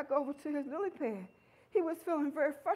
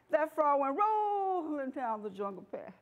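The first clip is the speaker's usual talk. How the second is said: ordinary delivery, nothing marked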